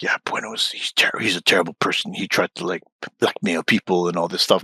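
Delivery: soft, hushed gossiping; mock gossip. Mocking people badmouthing Bueno, hushed gossip. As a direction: half-whisper it like gossip. Quoting gossip about him.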